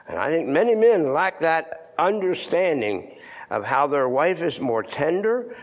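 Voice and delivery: ordinary talk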